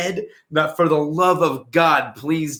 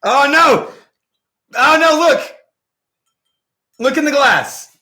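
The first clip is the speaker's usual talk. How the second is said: alarmed; 7 semitones higher, 5 dB louder than usual. Real, not acted. Sees hair in glass.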